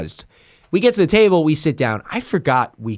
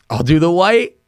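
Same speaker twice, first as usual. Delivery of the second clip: higher, through a held smile; smiling; 5 semitones higher than usual. Retold or imitated. Confused diner hands menu back, smiling. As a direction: imitate a friend answering with a smile. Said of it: "says it with, like, a smile like this".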